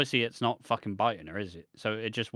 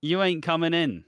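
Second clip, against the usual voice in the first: higher, louder, slow mocking taunt; taunting wrestler; 6 semitones higher, 5 dB louder than usual. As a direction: jeer at the old guys from the ring. Wrestlers taunting old-timers.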